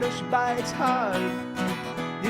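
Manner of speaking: usual speaking voice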